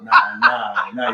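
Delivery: high loud protesting nah; laughing shout. Real, not acted. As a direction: shout nah in mock outrage. Protests as power fails.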